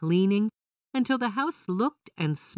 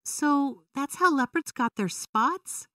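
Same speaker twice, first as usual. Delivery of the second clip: put-on child's question; 9 semitones higher than usual. A narrator's reading. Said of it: "Vera asked".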